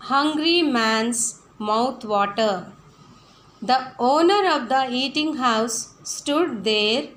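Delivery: put-on slow, loud, careful reading to children; slow reading aloud. Teacher reading the story to her pupils, slow and loud. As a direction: read each phrase out slowly and clearly for the class. Reads paragraph to class.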